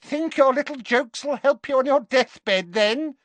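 high, loud villainess taunt; jeering. A narrator's reading. Alecto mocking Dumbledore's little jokes, jeering. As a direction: jeer it in a high put-on voice. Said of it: "she jeered".

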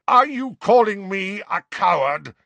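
loud, high outraged roar; Sirius, roaring. A narrator's reading. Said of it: "roared Sirius".

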